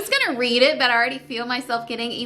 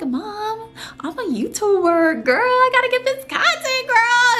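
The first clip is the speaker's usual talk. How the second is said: high-pitched, hammed-up plea to mom; exaggerated high-voiced begging; mock pleading; 13 semitones higher than usual. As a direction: whine playfully at your mom, pitched way up.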